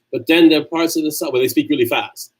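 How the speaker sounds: usual speaking voice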